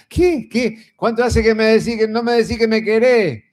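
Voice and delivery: comic deaf husband hollering at his wife; deaf husband shouting. Retold or imitated. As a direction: shout the question back like he can barely hear. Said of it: "que está medio sordo".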